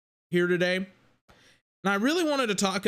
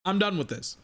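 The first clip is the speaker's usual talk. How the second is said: ordinary talk, nothing marked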